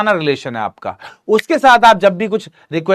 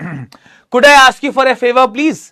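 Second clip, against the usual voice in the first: higher, louder, very slow polite ask; polite request; 6 semitones higher, 5 dB louder, much slower than usual. Shown as a demonstration. Teacher demos a polite request for a favor, slow and raised. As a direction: stretch the request out politely, lifting it high. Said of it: "बड़ा polite लग रहा है sentence".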